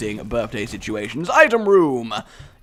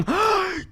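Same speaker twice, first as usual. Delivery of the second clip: huge high gasp of delight; excited child's gasp. Retold or imitated. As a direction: gasp like a kid seeing a present. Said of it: "my reaction was along the lines of, like, going".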